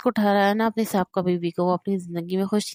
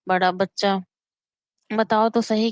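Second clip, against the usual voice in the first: plain reading voice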